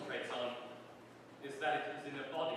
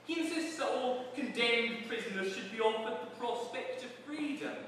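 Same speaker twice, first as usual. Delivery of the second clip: higher, louder jeering prison guard; hammed-up cockney warder; gloating warder; 7 semitones higher, 3 dB louder than usual. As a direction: crow it like a smug jailer. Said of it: "said the warder".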